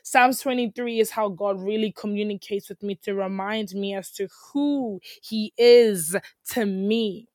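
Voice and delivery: normal talking voice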